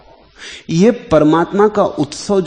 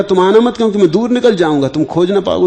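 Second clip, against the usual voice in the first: quick, raised, acted putting-off; retold anxious refusal; 5 semitones higher, 5 dB louder, faster than usual. Sage to women promising more food, hurried refusal. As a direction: wave them off hurriedly, higher and louder.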